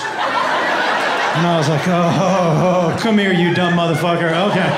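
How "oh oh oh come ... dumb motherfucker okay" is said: lightly voiced consoling dad, lower; mock-pitying dad. Dad gathering in his clueless son, low mock pity. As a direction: take pity on a hopeless kid, low.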